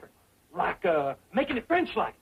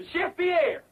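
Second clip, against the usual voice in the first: higher-pitched repeated summons in fake French accent; French-accented call; 7 semitones higher than usual. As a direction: call the missing chef, French accent, more insistent. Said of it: "I forgot my French accent".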